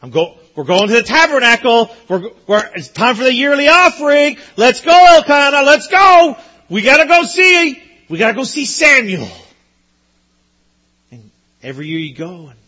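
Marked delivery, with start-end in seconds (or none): 0.0-9.3 high, loud, eager hurry; bursting to get going, calling her husband; excited mom. Pretend. Hannah urging Elkanah to visit Samuel, loud, high and eager. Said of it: "Can you imagine, moms?".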